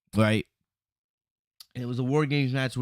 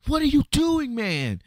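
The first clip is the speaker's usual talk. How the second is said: high-pitched mock yell, louder at the start; retold yell; 13 semitones higher, 3 dB louder than usual. Jay yelling at Sammy for hitting Roman, mock yell. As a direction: imitate a friend's shocked yell. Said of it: "Jay's yelling at Sammy".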